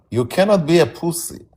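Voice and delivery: ordinary talk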